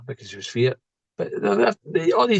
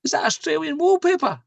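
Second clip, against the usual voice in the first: teasing question. Retold or imitated. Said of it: "I says to my mum".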